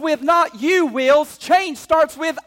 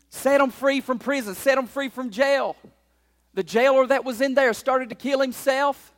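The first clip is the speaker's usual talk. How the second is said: ordinary delivery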